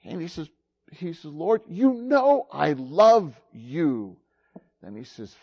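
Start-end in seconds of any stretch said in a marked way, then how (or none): 1.2-4.1 slow, mildly exasperated reply; a touch of hurt irritation, drawn out; a little perturbed. Acted. Peter, asked a third time by the Lord, mildly perturbed. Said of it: "Peter got a little perturbed".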